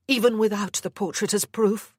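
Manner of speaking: a hint of a firm young mother; Anna, defiant. Anna answers her captor.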